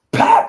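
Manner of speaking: high, loud comic pop; mock punch sound. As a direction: blurt it like a cartoon hit. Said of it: "Piccolo just goes".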